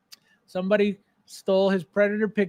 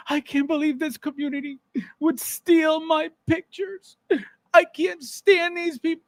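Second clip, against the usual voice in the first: mock whiny; 6 semitones higher than usual. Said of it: "He almost cried".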